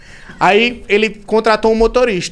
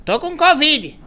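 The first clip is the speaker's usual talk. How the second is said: imitating grandma; 6 semitones higher, slower than usual. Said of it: "ligou pra mim e falou".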